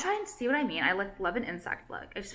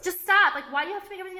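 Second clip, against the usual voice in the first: self-scolding snap; 10 semitones higher, 3 dB louder, faster than usual. Real, not acted. Scolding herself mid-ramble.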